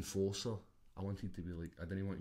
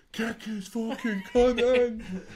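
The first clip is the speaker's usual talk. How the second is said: much higher, louder and very slow, roared from the corner; Scottish corner shout; 13 semitones higher, 10 dB louder, much slower than usual. Retold or imitated. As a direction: bellow it like a Scottish corner man on telly. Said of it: "It's Scottish".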